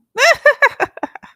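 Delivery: a shrill witchy cackle; high cackle. Pretend. Crazy woman cackling at the fleeing stalker.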